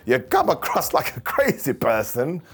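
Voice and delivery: ordinary talk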